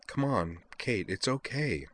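soft, slow, firm comfort; insisting gently. A narrator's reading. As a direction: coax her softly but firmly. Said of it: "Utna insisted".

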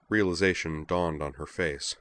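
plain reading voice

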